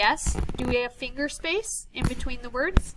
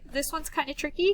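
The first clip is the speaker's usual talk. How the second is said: ordinary talk, nothing marked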